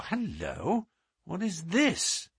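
slow, puzzled "hello"; suddenly intrigued, voice lifting; surprised discovery. A narrator's reading. Holmes spots something new.

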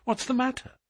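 high, urgent question; shocked cry. A narrator's reading. Narrator asking his friend about the dying father, shocked cry. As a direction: ask it high, in alarm. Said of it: "I cried".

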